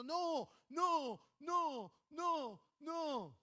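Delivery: loud, high, urgent no no; parent shouting no. Retold or imitated. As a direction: stop the child from danger, sharply. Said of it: "está uno diciendo". (padre gritando no, alarmado)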